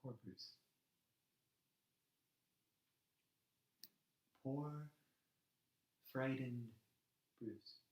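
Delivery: very soft, broken by long pauses; hushed pity. Acted. Man pitying poor frightened Bruce, hushed and sorrowful. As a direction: murmur it sorrowfully, almost to yourself.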